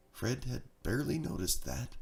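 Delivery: ordinary delivery, nothing marked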